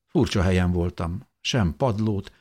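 usual speaking voice

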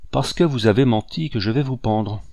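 plain reading voice

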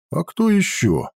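lightly surprised question. Acted. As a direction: ask it with mild surprise. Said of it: "удивился Ауранг".

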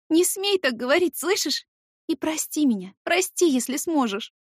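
high loud tearful pleading; tearful plea. Acted. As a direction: plead through tears for forgiveness. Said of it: "Громко всхлипываю".

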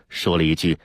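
plain reading voice

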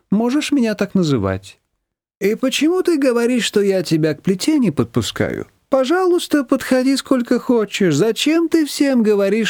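high, sugary mother-in-law; sweetly passive-aggressive; Manana's voice. A narrator's reading. Manana feigning kindness to Nina, sugary and high.

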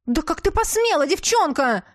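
high, loud outraged shriek; furious shriek. A narrator's reading. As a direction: shriek at the girl in fury. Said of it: "взвилась герцогиня".

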